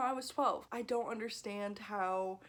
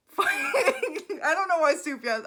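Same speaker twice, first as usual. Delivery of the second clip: laughing through the words, much higher and louder; 9 semitones higher, 11 dB louder than usual. Real, not acted. Vlogger cracking up mid-rant about [name], laughing loudly.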